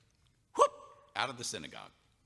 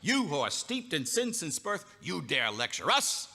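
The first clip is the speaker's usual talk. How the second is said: indignant outrage; 7 semitones higher, 6 dB louder than usual. Acted. Pharisees outraged at being lectured, loud and indignant. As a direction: scold him with outrage. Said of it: "highest levels of indignation".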